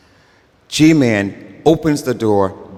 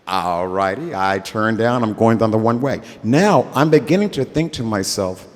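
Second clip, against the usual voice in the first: usual speaking voice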